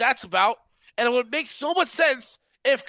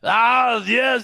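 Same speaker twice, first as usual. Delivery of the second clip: Schwarzenegger impression. To the co-hosts, trying Gunther as a mock Austrian Schwarzenegger. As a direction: bellow it like Arnold Schwarzenegger. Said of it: "Just think of Arnold Schwarzenegger".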